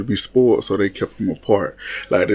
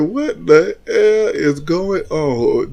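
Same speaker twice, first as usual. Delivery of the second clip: higher, drawn-out mock bafflement; higher-pitched, slow incredulous reaction; recalled disbelief; 6 semitones higher, much slower than usual. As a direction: stretch it out slowly in exaggerated disbelief.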